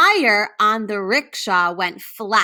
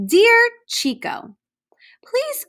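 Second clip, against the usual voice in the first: high whisper; 14 semitones higher, 4 dB louder than usual. Acted. Reading postcard aloud.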